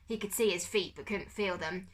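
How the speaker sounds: ordinary delivery, nothing marked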